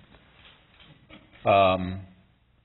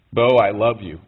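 louder, projected message to his son; emphatic direct address; 3 semitones higher, 8 dB louder than usual. Real, not acted. Father to his absent son Bo, louder, emphatic address. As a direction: speak out firmly, as if to reach someone absent.

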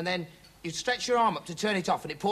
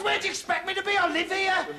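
high-pitched indignant comeback; indignant retort; 12 semitones higher than usual. Acted. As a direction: protest in a much higher voice. Answering back to boss.

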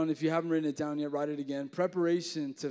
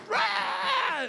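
mock scream; 4 dB louder than usual. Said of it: "we hear this, like".

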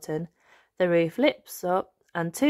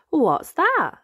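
high, louder little piglet asking; bright child question, higher and louder; Peppa voice; 9 semitones higher, 6 dB louder than usual. Acted. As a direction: pipe up as a curious small girl. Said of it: "asks Peppa".

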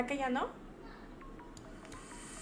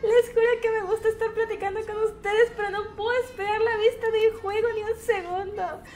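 higher, louder plaintive complaint; exasperated pleading; 7 semitones higher, 6 dB louder than usual. Real, not acted. Streamer pleading with viewers that she can't read and play, exasperated. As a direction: plead with the audience, voice raised.

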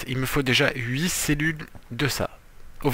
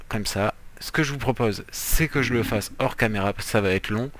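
ordinary talk, nothing marked

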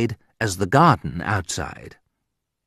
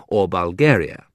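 ordinary delivery, nothing marked

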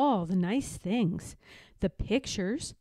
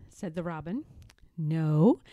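plain reading voice

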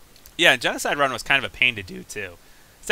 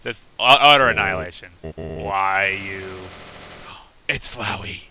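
ordinary talk, nothing marked

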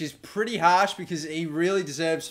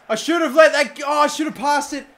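loud, higher cry of regret; self-blaming shout; 10 semitones higher, 8 dB louder than usual. Real, not acted. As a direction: shout at yourself for the mistake. Blaming himself after missed shot.

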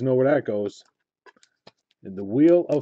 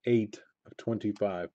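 quieter, falling murmur of the number; soft disbelief; 2 semitones lower, 8 dB quieter than usual. Real, not acted. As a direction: say it softly to yourself.

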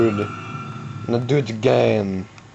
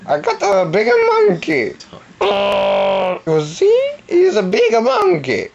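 Grand Moff Tarkin. Pretend. A villain bragging he has a bigger monkey, high voice. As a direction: pitch way up like a boastful cartoon villain. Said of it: "I'm a Grand Moff Tarkin".

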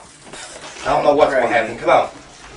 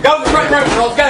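urgent shout; 7 semitones higher, 5 dB louder than usual. Real, not acted. Father orders girls out.

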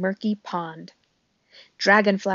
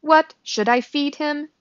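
higher-pitched robot voice; lightly voiced polite robot; 6 semitones higher, 4 dB louder than usual. A narrator's reading. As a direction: ask it in measured words. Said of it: "said the robot".

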